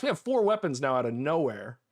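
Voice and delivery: ordinary talk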